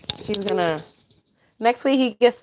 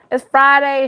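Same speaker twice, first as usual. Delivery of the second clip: excited Friday; 5 semitones higher, 6 dB louder than usual. Real, not acted. Repeated, louder higher.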